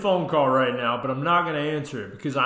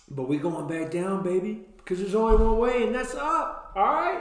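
climbing much higher into a hyped squeal; 7 semitones higher than usual. Acted. Host hyping his own weight loss, rising excitement. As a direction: ramp up like a caffeinated cheerleader. Hyped diet pep talk.

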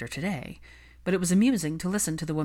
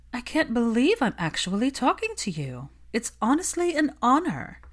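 higher, gushing society witch; higher fangirl voice for Olivia, a touch fake; practiced fan gush; 7 semitones higher than usual. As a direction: overplay the flattery like a rehearsed line. Olivia feigns awe.

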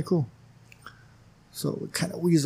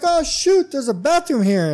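surprise; 12 semitones higher, 4 dB louder than usual. Retold or imitated. Discovering a hidden bathroom.

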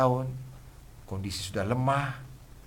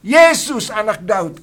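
shouted plea, much higher and louder; crying out; 11 semitones higher, 15 dB louder, slower than usual. Retold or imitated. Blind man shouting to Jesus for mercy. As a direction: call out over the crowd. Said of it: "Dan dia berteriak".

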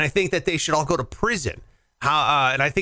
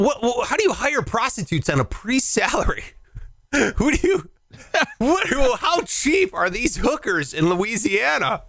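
high-pitched incredulous rant; comic disbelief; 7 semitones higher than usual. Pretend. Baffled co-host questioning how a priest affords prostitutes, comically incredulous. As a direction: squeal in baffled mock outrage.

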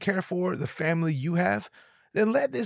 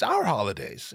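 normal talking voice

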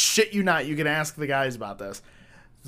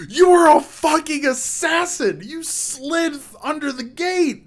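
very high, loud, drawn-out raving; awestruck hype; 14 semitones higher, 12 dB louder than usual. Retold or imitated. Imitating buddy amazed by the gate slide, ecstatic yelling. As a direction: scream it like an amazed best friend. Friend's awestruck reaction, retold.

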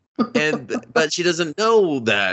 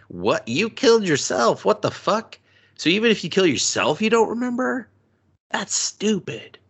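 higher, exasperated disbelief; much higher, exasperated incredulous voice; incredulous rant; 8 semitones higher than usual. Retold or imitated. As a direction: rant at a dumb plot hole. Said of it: "And I was like".

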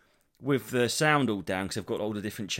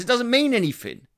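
higher and louder, incredulous; exasperated rant; 9 semitones higher, 5 dB louder than usual. Real, not acted. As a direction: rant at the absurdity. Exasperated rant about subtitles.

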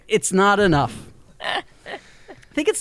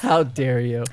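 mock outrage; 9 semitones lower, 3 dB quieter, slower than usual. Mock outrage at a joke accusation.

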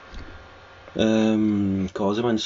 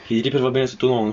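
ordinary delivery

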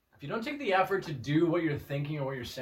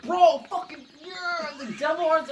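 hyped fan voice; 11 semitones higher, 4 dB louder than usual. Retold or imitated. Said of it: "He was like".

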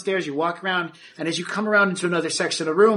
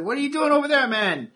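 worker's voice; 11 semitones higher than usual. Acted. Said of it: "He's like".

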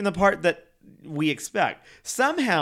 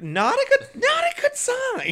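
climbing higher and higher into a squeaky pitch; rising squeal; 4 dB louder than usual. Acted. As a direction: let the voice soar up on each repeat. Escalating repeat of phrase.

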